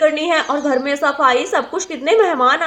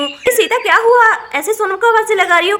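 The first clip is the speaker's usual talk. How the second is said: loud, high asking what happened; alarmed call; 5 semitones higher, 5 dB louder than usual. Acted. Elder sister rushes in, loud alarmed question.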